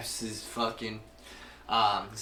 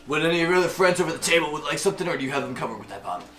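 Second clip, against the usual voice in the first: louder, hurried tavern server voice; bartender; 6 semitones higher, 10 dB louder, much faster than usual. Acted. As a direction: call out briskly to a customer. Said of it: "he's like".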